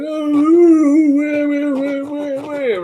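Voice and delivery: high, loud hammed-up wailing; mock crying. Watching wrestling alone upstairs, mocking the crying wrestlers with hammed-up wails. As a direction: fake sobbing to mock the wrestlers. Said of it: "I'm mocking this at first".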